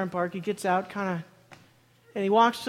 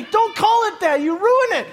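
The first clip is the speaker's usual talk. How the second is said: mock protest; 7 semitones higher, 6 dB louder, slower than usual. Joking protest.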